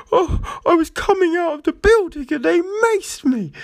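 high, whining mock cry; high-pitched hammed-up whimpering; mock tearful woman. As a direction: parody her tearful complaint. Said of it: "She's just like".

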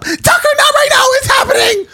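very high, frantic yell at the dog. Retold or imitated. Man shouting at his dog mid-proposal, frantic high yell. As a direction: shriek it, panicked and high.

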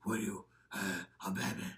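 mocking whispered superhero voice; Batman. Host mocking Ben Affleck's Batman to co-host, stupid voice. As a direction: whisper it as a silly put-on parody. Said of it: "he has that stupid voice".

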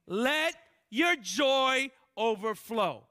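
loud, slow proclamation; stretched, booming blessing; proclaimed. Real, not acted. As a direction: call it out over the room slowly. Preacher proclaiming loudly.

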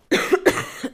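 a real coughing fit. Real, not acted. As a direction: cough hard, unvoiced. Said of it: "Sorry, ik moest even hoesten".

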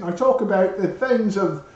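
high, lilting English accent. Shown as a demonstration. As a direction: put on a playful English accent. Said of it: "if I do an English accent".

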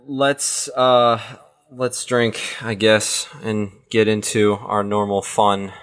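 low, halting, reluctant lead-in; unexcited. Real, not acted. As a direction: say it like it's a chore. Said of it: "You don't sound excited".

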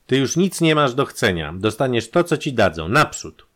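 loud, high put-down; rough command. A narrator's reading. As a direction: order the prisoner off forcefully. Prisoner's demand is roughly refused.

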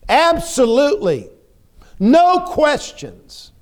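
voicing a believer with no doubt at all; confident yes. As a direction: declare it with total certainty, stretching the last words slowly. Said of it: "How can we go".

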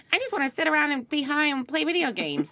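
put-on high, lazy stoner teen voice; older kid voice; stoned teen. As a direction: whine like a bored teen who's high. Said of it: "kids gonna be like".

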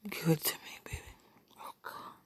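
very soft, longing whisper; intimate whisper. Real, not acted. Murmuring love to a partner over the phone, whispered. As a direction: whisper it, barely any voice. Intimate message to absent lover.

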